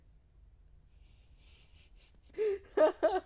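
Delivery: high, loud helpless laugh; laughing out loud at the script; real burst of laughter. Real, not acted. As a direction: crack up mid-sentence. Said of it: "this is too funny".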